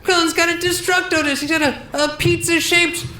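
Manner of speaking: Greg Giraldo. Acted. Comedian Giraldo ranting about Krillin, high strained voice. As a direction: push it way up and strained. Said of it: "This is Greg Giraldo".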